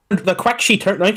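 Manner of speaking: ordinary delivery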